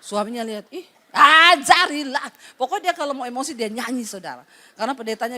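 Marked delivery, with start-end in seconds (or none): 0.7-2.3 menirukan nyanyian dengan nada mengejek, keras dan lambat; ejekan heran. Retold or imitated. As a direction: ulang sambil mencibir. Suami mengejek nyanyian istri. (suami mengejek istri yang menyanyi)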